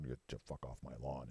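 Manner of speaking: dog grousing at passersby, low grumble; grumbling dog voice. Retold or imitated. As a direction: mutter it like an old grouch of a dog. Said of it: "he's got that grumble".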